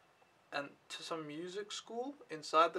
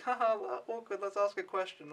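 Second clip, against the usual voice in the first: higher, mock-awkward girlish giggle; giggly shy girls; 5 semitones higher than usual. Imitating girls daring each other to ask, higher giggly voice. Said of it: "they were sort of like".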